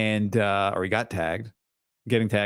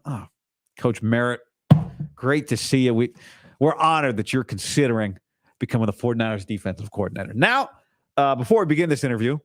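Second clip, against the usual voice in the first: light Southern accent, raised and mock-polite; Southern John Lynch; faster than usual. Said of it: "John Lynch is Southern in this scenario".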